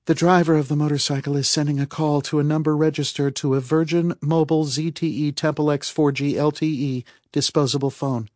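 high, fast, matter-of-fact data readout; Calpurnia reporting. A narrator's reading. Narrator voices Calpurnia reporting a call.

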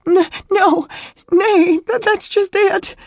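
very high, loud, stammering sobs; distraught denial. A narrator's reading. Weeping woman telling him he misunderstands, distraught. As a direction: wail the correction tearfully.

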